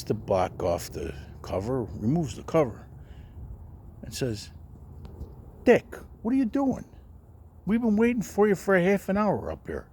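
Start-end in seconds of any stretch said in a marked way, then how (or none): 5.6-9.9 high, loud fake puzzlement; mock innocent. Angelo, after the prank, pretending to wonder where Dick went. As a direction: play dumb, as if he's been kept waiting.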